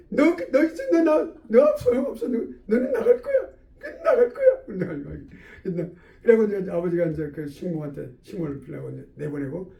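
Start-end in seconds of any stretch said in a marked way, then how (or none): 0.1-4.6 put-on very high, taunting child voice; child telling off. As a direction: dismiss the maid like a defiant kid. (아이처럼 높은 소리로 쏘아붙이듯)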